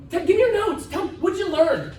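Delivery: mock eager demand; demanding mimicry. Mimicking people questioning event-goers.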